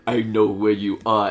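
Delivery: menacing deep voice; ominous threat. Retold or imitated. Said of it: "ominous and be, like, like, very threatening".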